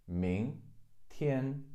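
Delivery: 明 rising second tone, then 天 high level; teacher models the tones of 明天; syllable by syllable. Shown as a demonstration. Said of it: "Second tone, first tone".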